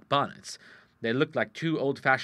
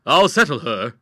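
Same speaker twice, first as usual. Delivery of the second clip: put-on loud young man's answer; masculine voice; 3 semitones higher, 12 dB louder than usual. Nephew answering his aunt's call, loud and masculine. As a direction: call back as a capable nephew. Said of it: "answered a masculine voice".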